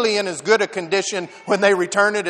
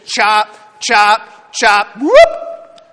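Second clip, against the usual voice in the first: rhythmic chop chop then a rising whoop; chopping sounds; 5 dB louder, much slower than usual. Acted. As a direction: mime the axe strokes, whoop as it flies. Acting out chopping axe.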